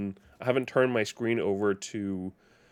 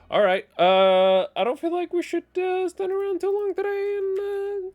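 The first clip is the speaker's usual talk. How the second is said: much higher silly announcer voice; high put-on voice; 6 dB louder than usual. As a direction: jump way up into a squeaky joke voice. Streamer opens segment in put-on voice.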